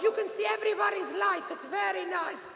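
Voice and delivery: high, soft, slowed mom voice; imitating mom. Mother explains why she likes Facebook, imitated mom voice. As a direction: play mom in a high lilting voice. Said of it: "She goes".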